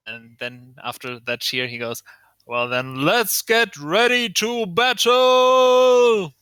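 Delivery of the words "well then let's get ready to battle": high, loud, slow battle cry; ring announcer. Acted. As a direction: build to a long held final word. Announcer starts the fight.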